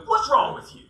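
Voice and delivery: sharp, high, exasperated snap; the guide scolding a clumsy angler. Retold or imitated. Annoyed guide scolding a client who missed the fish.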